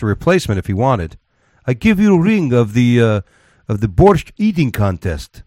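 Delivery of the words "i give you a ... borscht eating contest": hammed-up Russian accent as Putin. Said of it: "This isn't the worst Russian accent ever".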